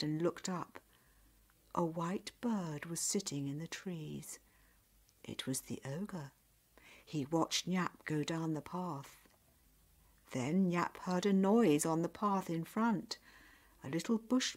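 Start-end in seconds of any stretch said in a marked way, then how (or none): none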